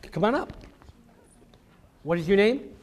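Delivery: ordinary talk, nothing marked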